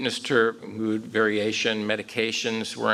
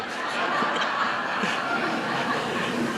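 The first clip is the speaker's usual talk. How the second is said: breathy laugh. Real, not acted. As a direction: laugh off the textbook line. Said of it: "thank God I bit my tongue".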